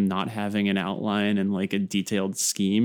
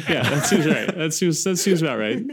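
laughing; 8 semitones higher, 4 dB louder than usual. Real, not acted. Laughing at friend's metaphor.

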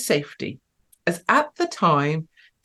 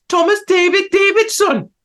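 high, loud, sing-song mock Welsh; Welsh accent; 14 semitones higher, 6 dB louder than usual. Joking to chat that he's gotta be Welsh, mock Welsh accent. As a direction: put on a hammed-up Welsh accent. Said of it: "not a very good Welsh accent".

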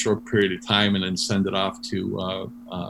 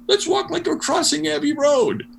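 very high, playful imitated suggestion; animated retold quip, pitched way up; excited call; 4 dB louder than usual. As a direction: call it out to the group. Acting [name]'s gleeful call to others.

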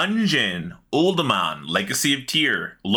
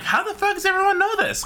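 baffled; faster than usual. Retold or imitated. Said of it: "and I'm like".

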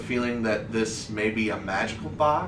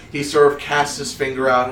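ordinary delivery

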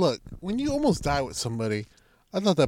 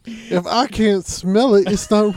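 put-on old prospector; 5 semitones higher, 5 dB louder, slower than usual. Continuing old man bit.

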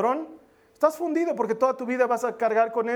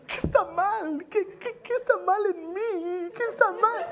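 much higher, slow, tearful wail; mock sobbing; 9 semitones higher than usual. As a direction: whimper like a rejected contestant, half crying. Rejected contestants crying in limousine. (llanto exagerado de concursante rechazada, mucho más agudo y lento)

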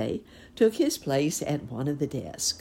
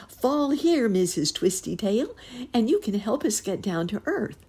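calling out; 8 semitones higher, 3 dB louder than usual. A narrator's reading. Calling to the falling pig.

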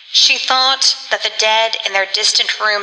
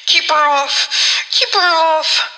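high, drawn-out cry of terror; terrified plea; 7 semitones higher, slower than usual. A narrator's reading. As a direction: beg for protection, pitch climbing on the repeat. Terrified woman fends off a ghost.

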